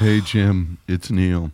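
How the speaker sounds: low, deadened caller voice; deep, odd monotone phone greeting; Neil Breen. Acted. Weird four a.m. phone call to Jim, low impersonation. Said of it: "that's a pretty good impersonation of Breen".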